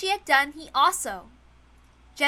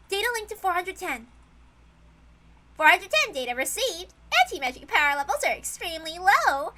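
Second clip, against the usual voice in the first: put-on soldier girl voice; 6 semitones higher, 5 dB louder than usual. Soldier sisters report targets.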